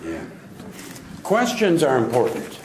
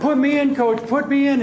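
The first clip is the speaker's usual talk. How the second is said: much higher, eager repeated plea; excited kid begging; 13 semitones higher, 4 dB louder than usual. Retold or imitated. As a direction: bounce with a kid's eagerness, begging the coach. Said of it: "He's all excited".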